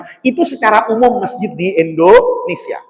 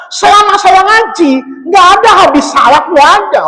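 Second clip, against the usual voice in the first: incredulous; 10 semitones higher than usual. Real, not acted. Indignant disbelief about salak hadith.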